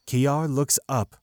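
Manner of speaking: normal talking voice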